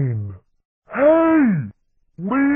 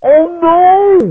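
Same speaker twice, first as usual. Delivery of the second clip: high, loud cartoon panic; panicked cry; 6 semitones higher, 8 dB louder than usual. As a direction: wail it out in acted alarm. Pilot reacts to danger.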